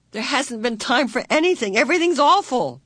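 distressed. A narrator's reading. Meg despairs to Calvin.

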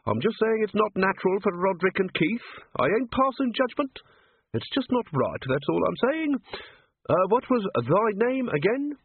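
put-on high, rustic old man's voice; chatty old yokel showing off his birds; lightly cracked old keeper voice; old zoo-keeper.

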